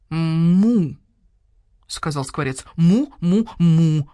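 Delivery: loud imitated cow moo; a starling imitating a cow, loud. Starling moos.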